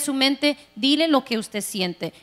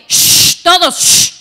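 1 loud hushing; 4 semitones higher, 9 dB louder, slower than usual. Retold or imitated. Husband hushing family on phone.

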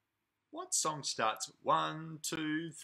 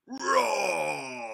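dinosaur roar; 7 dB louder than usual. Shown as a demonstration. Teacher shows children how to roar, loud playful roar. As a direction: roar like a big dinosaur for the kids. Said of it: "can you make a roaring sound".

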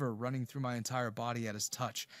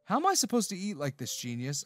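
higher, louder sarcastic jab; sarcastic retort; 6 semitones higher, 4 dB louder than usual. Acted. Isaac mocking Aiden, tied-up hands, sarcastic retort. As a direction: throw his own sarcasm back at him. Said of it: "I retorted".

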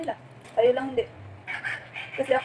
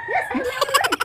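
excited. Real, not acted. Insisting she can buy a bike.

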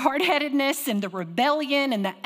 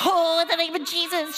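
mimicking child; 8 semitones higher, 4 dB louder than usual. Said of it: "toddler prayer retold".

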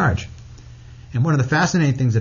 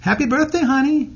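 high sweet mother voice, much higher; imitating his mother; 14 semitones higher than usual. Mother calling her son on his birthday, high sweet voice. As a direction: sing it out like a loving mom on the phone. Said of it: "and she says".

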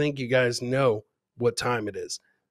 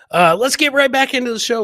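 hype; 9 semitones higher, 8 dB louder than usual. Real, not acted. Host relaunching the show.

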